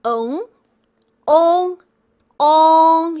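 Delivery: ong said three times, each sound held out; model sound ong. Shown as a demonstration. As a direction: drill the final ong for the class. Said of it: "this is the longest and this is the smallest".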